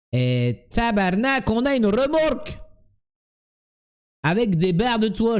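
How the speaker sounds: put-on high, exclaiming Québécois accent. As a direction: cry out in hammed-up Québécois. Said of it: "quand tu imites les Québécois".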